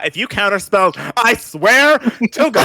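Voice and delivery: playful warning. Real, not acted. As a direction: threaten in mock outrage, laughing at the end. Warns GM against counterspelling.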